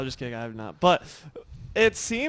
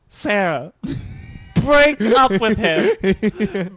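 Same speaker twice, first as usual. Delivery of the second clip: loud, high, slow mock cheerleading of a friend; a hammed-up chorus urging her to dump him; mock egging on; 4 semitones higher, 8 dB louder, slower than usual. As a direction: hype her up like pushy roommates. Roommates egging her on retold.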